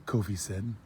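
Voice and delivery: ordinary delivery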